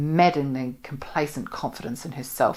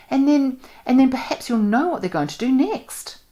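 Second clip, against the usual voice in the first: lightly voiced young woman, higher and eager; earnest young woman; 8 semitones higher than usual. A narrator's reading. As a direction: let her build up excitedly about the idea. Joanna voiced by narrator.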